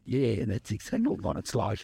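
low, dry nun's voice; deadpan nun. Retold or imitated. The little nun answering him without missing a beat, low and dry.

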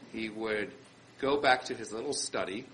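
ordinary delivery

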